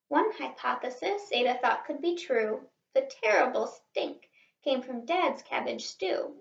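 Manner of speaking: ordinary delivery, nothing marked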